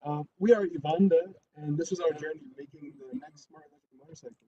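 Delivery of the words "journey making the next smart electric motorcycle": soft, fading presentation voice; hard-to-hear, softly spoken introduction; too quiet to hear. Real, not acted. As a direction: let the sentence drop away softly. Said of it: "Speak louder".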